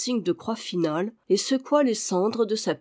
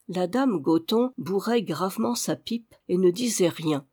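plain reading voice

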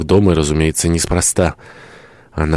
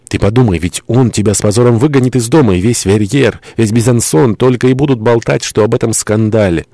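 raised, higher-pitched alarmed warning; urgent warning; 5 semitones higher, 3 dB louder than usual. Acted. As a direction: press the danger on a lover. Urgent warning to his lover.

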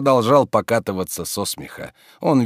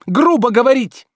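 very high, loud rebuke; outraged; 8 dB louder than usual. A narrator's reading. Master rebuking servant's rudeness, high outrage. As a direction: squawk the rebuke indignantly.

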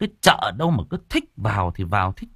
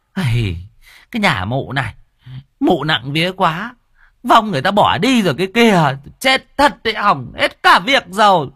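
angry complaint; 9 semitones higher, 6 dB louder than usual. A narrator's reading. Cô An blames bà Nụ angrily.

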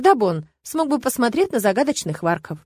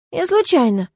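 put-on high-pitched, pitiful little boy; child pleading innocence; small tearful voice, lightly done; plaintive whimper; 8 semitones higher than usual. A narrator's reading. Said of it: "жалобно всхлипнул ребёнок".

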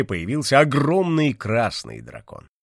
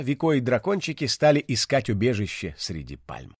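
ordinary delivery

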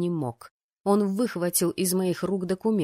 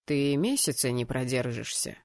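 low, patronizing prediction; condescending, pitched-down male voice; condescending fiancé; 6 semitones lower than usual. Acted. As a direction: talk down to her in a deeper voice. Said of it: "снисходительно пояснил жених".

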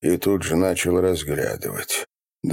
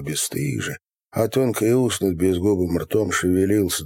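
ordinary delivery, nothing marked

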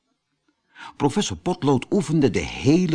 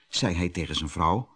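ordinary delivery

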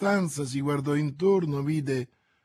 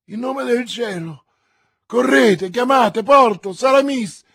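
high, very loud urgent orders; panicked cry, building in force; frantic shout; 9 semitones higher, 11 dB louder than usual. A narrator's reading. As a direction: shout desperately for help. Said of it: "gridò D'Artagnan".

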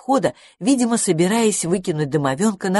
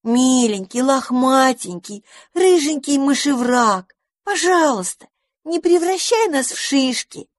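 very high, slow wheedling plea; pleading; 7 semitones higher, slower than usual. A narrator's reading. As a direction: coax sweetly in a high squeak. Squirrel kids pleading.

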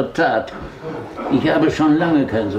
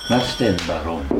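ordinary delivery

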